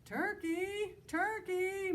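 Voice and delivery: a sugary sing-song call, very high; sweetest voice. Acted. Mister Moose sweetly calling Turkey out of his nest. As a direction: coax him out with a sweet, lilting call. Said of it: "called in his sweetest voice".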